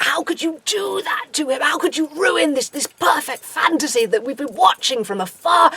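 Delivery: hammed-up indignant protest; mock outrage. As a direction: wail it like a scandalised fan. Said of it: "a lot of outrage".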